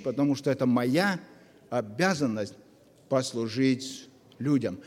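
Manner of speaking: ordinary talk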